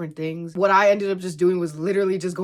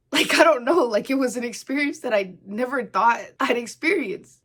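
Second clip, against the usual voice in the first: choked up; 6 semitones higher, 3 dB louder than usual. Real, not acted. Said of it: "I'm sorry that I'm crying".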